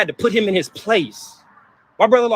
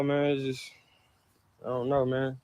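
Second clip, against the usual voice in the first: quiet grief; 6 semitones lower, 11 dB quieter than usual. Real, not acted. Grief about mother.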